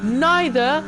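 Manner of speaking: real thrilled commentary at the top of the voice; ecstatic. Real, not acted. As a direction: shout it like you just took pole. Takes pole, celebrates.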